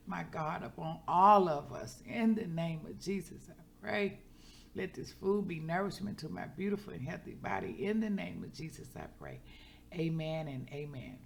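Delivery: bowing heads, she leads grace, hushed and earnest; heartfelt prayer. Real, not acted. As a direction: pray it sincerely over the meal.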